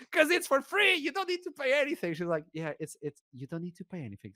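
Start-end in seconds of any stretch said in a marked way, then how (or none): 0.1-2.1 high-pitched, giggly mock explanation; amused explanation. Telling a poor passenger it is free, high and amused. 2.5-4.3 soft, hushed stewardess explanation; gentle reassurance. Retold or imitated. Stewardess tells embarrassed passenger it is free, softly.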